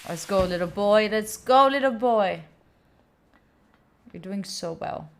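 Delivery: usual speaking voice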